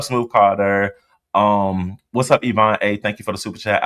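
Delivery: normal talking voice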